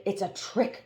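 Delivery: ordinary delivery, nothing marked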